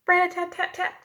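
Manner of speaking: high quick rat-a-tat; woodpecker. A narrator's reading. Teacher imitates a woodpecker, high and quick. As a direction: tap it fast like a little hammer. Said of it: "sounds like a little hammer".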